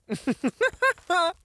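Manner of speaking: high, falling cackle, gleeful; demonic cackle. Acted. Demon cackling at the slow mage, high gleeful laugh.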